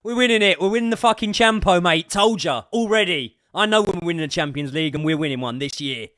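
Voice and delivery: high, loud cocky chant-like boast; triumphant boasting. Real, not acted. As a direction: rub it in the doubters' faces.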